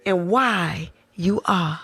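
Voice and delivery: put-on voice. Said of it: "What is that voice".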